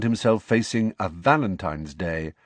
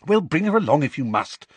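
urgent; 6 semitones higher than usual. A narrator's reading. Hermione presses Harry to meet her, urgent. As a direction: hurry him into agreeing. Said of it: "said Hermione urgently".

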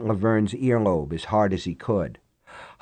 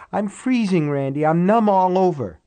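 whining; 8 semitones higher, 4 dB louder than usual. Acted. Cold woman complaining.

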